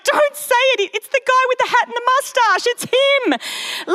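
high, pleading, don't say it; cringing viewer. Retold or imitated. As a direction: squeal it like a tense TV viewer. Viewer cringing at undercover boss.

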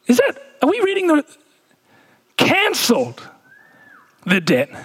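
mock confused.

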